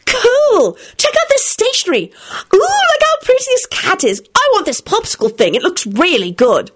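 put-on high, eager little girl voice; girl squealing over presents; excited Rosie. A narrator's reading. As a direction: gush over every bag like a kid.

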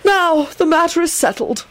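high, firm ruling. A narrator's reading. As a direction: close the argument firmly. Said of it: "Albus said firmly".

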